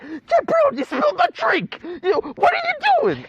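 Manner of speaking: high, loud mock shout of his buddy; mocking his friend. Teasing his friend by imitating him, high mock shout. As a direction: squeal it like an outraged bro. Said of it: "you're like".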